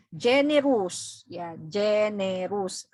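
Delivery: generous drawn out slowly, syllable by syllable. Shown as a demonstration. Teacher slows generous down for the class. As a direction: say generous slowly to count its beats. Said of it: "three syllable siya".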